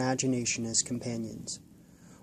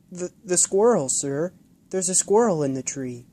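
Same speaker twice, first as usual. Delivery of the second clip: put-on high, louder stammering child; nervous boy; 5 semitones higher, 5 dB louder than usual. Boy nervously answering the old man. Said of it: "Nervous, the boy's lip quivered".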